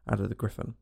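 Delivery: ordinary delivery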